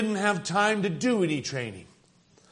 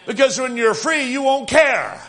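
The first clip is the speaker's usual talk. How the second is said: preaching shout; 5 semitones higher than usual. Real, not acted. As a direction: lift the church with it.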